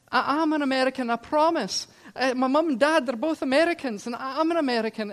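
put-on high, loud, urgent protest of a boy; pleading boy. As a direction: insist desperately you're telling the truth. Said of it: "And I said".

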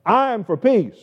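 I'm for peace high and loud; ringing emphatic claim of peace. Shown as a demonstration.

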